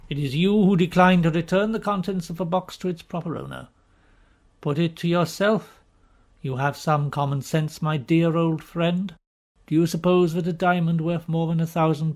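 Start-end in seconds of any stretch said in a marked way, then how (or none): none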